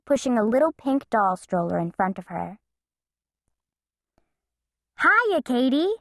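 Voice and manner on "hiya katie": higher, calling to a friend. Acted. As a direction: call across the street. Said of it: "called Bean".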